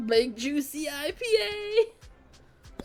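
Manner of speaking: slow, cracking up through it; laughing. Real, not acted. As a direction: say it while dissolving into laughter. Laughing repeat of the phrase.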